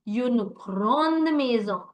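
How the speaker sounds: slow "une grande maison", leaning on the d of "grande"; emphasis on de. Shown as a demonstration. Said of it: "why the emphasis on the de a little bit".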